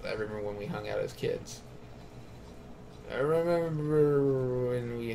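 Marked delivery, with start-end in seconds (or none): 0.1-1.6 soft and fast, reading it off; quick quiet read. Retold or imitated. Player picking the option, fast and soft. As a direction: rattle it off under the breath. Reads the chosen option again.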